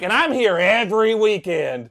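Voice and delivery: high, loud bully warning; scary big kid. Retold or imitated. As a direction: drive the threat home, mock menacing. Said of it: "scary kid comes up to me".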